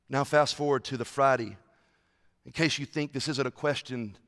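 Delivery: low, soft, solemn retelling; hushed sermon storytelling. Acted. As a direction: draw them in quietly to the cross. Hushed telling of the crucifixion.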